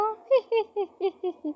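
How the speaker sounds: high mocking titter; mocking laugh. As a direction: titter at her expense. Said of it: "उस पर हंसने लगती है".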